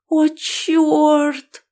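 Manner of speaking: high, startled oh no; panicked gasp. A narrator's reading. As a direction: blurt it out in panic. Heroine panics at brother's arrival.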